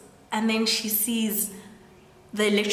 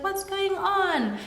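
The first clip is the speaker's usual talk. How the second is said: confused question; 11 semitones higher, slower than usual. Retold or imitated. Kat comes down, confused.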